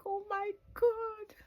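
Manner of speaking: overwhelmed, voice breaking into tears; crying with joy. Real, not acted. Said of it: "I was like".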